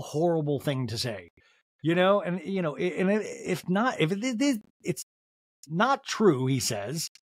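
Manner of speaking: usual speaking voice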